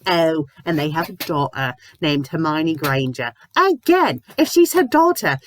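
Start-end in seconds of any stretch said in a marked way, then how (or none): 3.6-5.4 high, loud, fed-up rant; exasperated yelling. Real, not acted. Reviewer ranting at the fanfic's naming logic, exasperated yell. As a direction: lose patience with the story. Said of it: "okay, I need to breathe".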